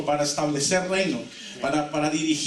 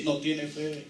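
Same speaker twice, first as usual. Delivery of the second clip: vampire quote; 6 dB quieter, slower than usual. Retold or imitated. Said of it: "mire lo que Hollywood dice".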